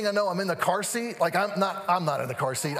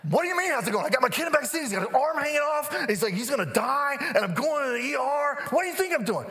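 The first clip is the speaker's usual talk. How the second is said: high-pitched mock hysterical rant; frantic outburst; 6 semitones higher than usual. Panicked dad on the phone to his doctor, frantic. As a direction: re-enact a dad losing it, pitched way up.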